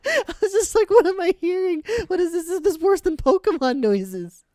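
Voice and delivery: laughing. Real, not acted. Reacting to their own impression, laughing and high. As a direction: giggle through the question. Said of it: "laughing after the imitation".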